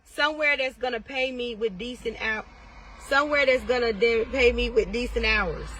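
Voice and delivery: normal talking voice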